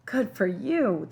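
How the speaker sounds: slow, drawn-out cheer. A narrator's reading. Old man congratulates the boy on his film, cheering. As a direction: cheer him on, stretching the words. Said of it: "the old man cheers".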